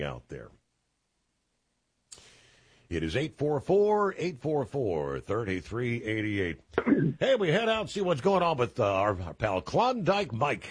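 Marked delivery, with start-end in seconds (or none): 2.9-10.7 higher, punchy radio host intro; radio call-in announcer. Acted. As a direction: sing out the phone number, then bring on the caller. Radio host gives number, introduces caller.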